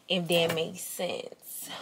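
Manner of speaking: slow, breathy whisper to herself. Real, not acted. Getting ready on camera, whispering to herself.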